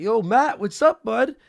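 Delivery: high, loud, friendly yo; walking into his old workplace, a loud cheery hello; cheery greeting. Retold or imitated. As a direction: greet an old buddy brightly. Retold cheerful greeting to ex-coworker.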